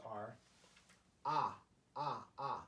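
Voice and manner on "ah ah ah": "ah" as an open vowel, repeated; Korean A sound. Shown as a demonstration. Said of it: "The sounds that these, uh, characters produce".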